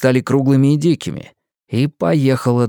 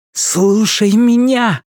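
high, slow, pressing call; urgent shout; 9 semitones higher, 3 dB louder, much slower than usual. Acted. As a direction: demand his attention. Urgent plea.

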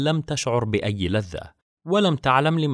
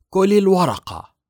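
high, loud, falsely sweet command; sweetly cruel order; 10 semitones higher, 7 dB louder than usual. A narrator's reading. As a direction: smile while giving a cruel order. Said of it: "ابتسمت سون غيليان ابتسامة لطيفة". (أمر بابتسامة لطيفة قاسية)

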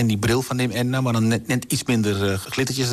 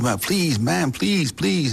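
rising, higher begging please; a touch of a cowering director begging; pleading director; 7 semitones higher, much slower than usual. Retold or imitated. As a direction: plead with the angry mother. Director begs the furious mother.

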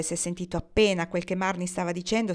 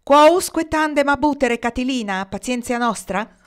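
loud, high fiery oration; orator's heated challenge; impassioned retort; 5 semitones higher, 8 dB louder than usual. A narrator's reading. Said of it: "stava rispondendo loro con foga".

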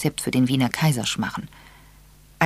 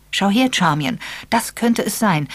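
higher and louder with sudden excitement; excited; 7 semitones higher, 5 dB louder than usual. Acted. Edward shows Charmian the recipe, excited and loud. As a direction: grab her attention, it's a discovery.

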